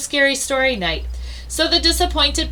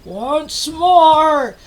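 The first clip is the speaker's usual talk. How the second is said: muffled call; 5 semitones higher, 5 dB louder than usual. Acted. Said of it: "his muffled voice calling".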